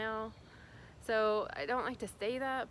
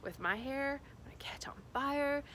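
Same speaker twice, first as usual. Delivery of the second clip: put-on hushed, breathless childhood fright; whispered fear; 5 semitones higher than usual. Little girl under falling ash, whispering in fear. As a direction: whisper it as if sparks are falling on your hair. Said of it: "And I'm like".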